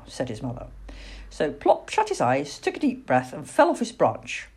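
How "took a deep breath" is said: ordinary delivery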